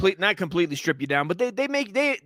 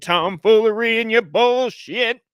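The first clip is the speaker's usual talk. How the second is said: put-on very old man; 5 dB louder than usual. Old man word, grumpy elder.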